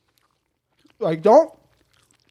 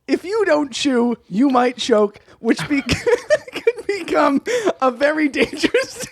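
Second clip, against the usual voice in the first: higher, slowed reading breaking into giggles; cracking up; 5 semitones higher, slower than usual. Real, not acted. Cohost reading an obvious choking tip, cracking up. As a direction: struggle to finish through laughter.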